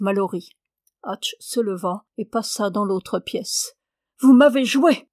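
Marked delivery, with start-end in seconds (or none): 4.2-5.1 loud, higher-pitched angry outburst; furious cry. A narrator's reading. Woman accusing the professor of tricking her, furious cry. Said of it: "cria tout à coup la femme avec fureur".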